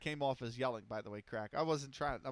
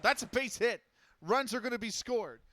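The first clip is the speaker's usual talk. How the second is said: higher, louder rising call of a base hit; excited call; 6 semitones higher, 5 dB louder than usual. Acted. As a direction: lift it as the runs come in. Base hit, runs scoring.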